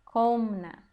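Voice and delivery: komna with a strong, long first syllable KO; stress on KO. Shown as a demonstration. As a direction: punch the KO in komna, lighter -na. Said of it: "a sílaba forte é o ko".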